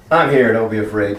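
low-pitched, steady reassurance; Jesus reassuring. Acted. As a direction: settle their fear in a low voice. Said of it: "Jesus says".

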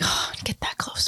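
sighing, hushed whisper. Retold or imitated. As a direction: breathe it out like a sad sigh. Imitating a loser's sigh.

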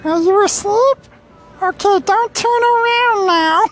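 creepy high puppet falsetto, loud; Elmo. Acted. Murderer confession done as a children's puppet, creepy high falsetto. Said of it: "but in the voice of Elmo?".

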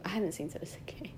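fast, hushed whisper; guilty whisper confession. Real, not acted. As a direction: admit it under your breath.

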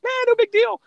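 high, breezy mock voice of a cocky kid; imitating relaxed players shrugging off the final; carefree teen player. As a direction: toss it off like nothing matters.

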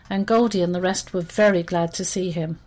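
plain reading voice

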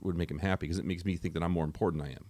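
ordinary talk, nothing marked

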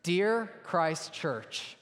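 ordinary delivery

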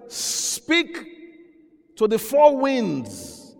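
very high, sharp command; divine command. Acted. Preacher voices God ordering the prophet to speak, high command. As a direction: cry it out as a command.

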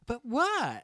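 high, ditzy "But what?"; drunk girl impression. Imitating the drunk girl's reply to Dave, ditzy voice. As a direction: play her airy and baffled. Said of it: "I'll never forget her reaction".

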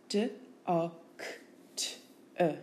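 D O C T E as separate phonemes; sound by sound. Shown as a demonstration. Teacher giving a spelling word's sounds, sound by sound. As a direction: sound out D O C T E with gaps. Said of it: "Listen carefully to the sounds".

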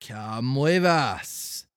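officer cuts Pinocchio off firmly; slow firm order. Acted. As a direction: spell it out word by word.

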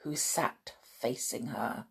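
ordinary delivery, nothing marked

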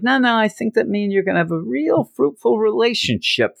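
high put-on character voice; imitated foreigner. Foreigner proposing a partnership to the ruined man, imitated voice. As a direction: voice the foreigner far above your own pitch. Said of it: "And the foreigner's like".

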